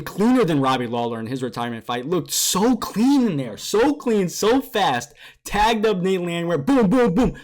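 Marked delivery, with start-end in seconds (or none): 2.1-7.3 hyped recap. Real, not acted. Hyped on a fight recap, booms rising. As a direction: sell the knockout, voice climbing.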